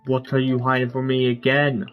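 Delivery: ordinary delivery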